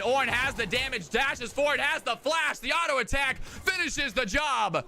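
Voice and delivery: hyped esports caster call; live play-by-play. Acted. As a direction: call the fight fast and excited.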